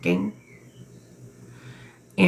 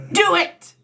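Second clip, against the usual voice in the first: much higher and louder, nearly yelling; excited urging; 13 semitones higher, 5 dB louder than usual. Real, not acted. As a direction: urge them on with real excitement. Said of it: "I'm sorry if I'm yelling at you".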